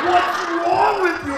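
high, loud, stretched-out scolding shout; stepfather's angry yell. Retold or imitated. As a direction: yell it in exasperated outrage. Enraged stepfather, woken by yelling.

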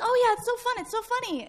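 high, sing-song mock amusement. Teen friends making fun of the incident, mocking. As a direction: toss it off as a joke. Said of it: "making fun of it".

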